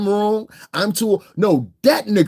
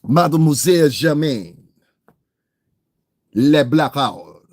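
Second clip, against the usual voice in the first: mock French; 4 dB louder than usual. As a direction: announce the nickname in put-on French. Mock French nickname for rival.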